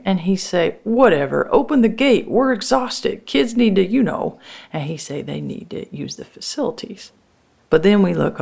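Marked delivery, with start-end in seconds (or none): 0.8-4.4 high-pitched, exasperated demand; impatient brother. Acted. Tired brother wanting the farm gate opened, impatient. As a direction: push her impatiently, voice riding high.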